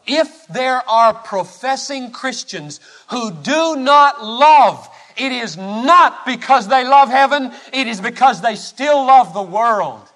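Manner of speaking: high, loud, emphatic pulpit delivery; forceful preaching. Acted. Preacher on professing Christians who do not love, forceful preaching. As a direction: drive the argument home forcefully.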